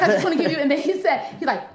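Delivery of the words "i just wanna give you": higher, louder nervous retelling. Retold or imitated. Young comedian offering her DVD to Bernie Mac, nervous. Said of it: "Did you sound this nervous that you sound right now?".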